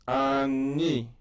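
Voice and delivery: Ani said loud and clear; Hebrew reading demo. Shown as a demonstration. Teacher reads Ani from chart, clear model. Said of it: "reads chart word".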